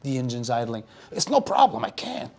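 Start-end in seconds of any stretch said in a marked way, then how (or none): 1.1-2.3 driver voice. Acted. Driver Howie offers to wait.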